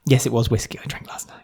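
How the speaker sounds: whispered as if confessing a secret; a whispered, playful hangover confession; mock confession whisper. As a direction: hush it like a guilty admission. Confessing as hungover Kareen.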